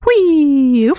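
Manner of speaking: high, drawn-out gliding "whee"; playful whee. Pretend. Player taking a jump of faith, playful whee. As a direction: let out a high whee that slides down. Jumping off without wings.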